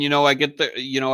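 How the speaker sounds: ordinary talk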